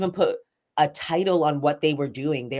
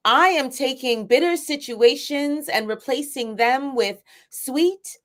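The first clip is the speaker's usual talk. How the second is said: high, measured spoken-word recital with emphatic line openings; spoken-word poetry; 9 semitones higher than usual. Shown as a demonstration. Poet sharing a piece on request, spoken-word performance. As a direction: perform it as a declaimed affirmation poem. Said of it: "would you mind saying a spoken word".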